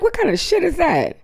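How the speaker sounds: high-pitched incredulous question; retold incredulity. Speaker reacting to the staff-parent row, high-pitched incredulity. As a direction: react in disbelief, voice way up.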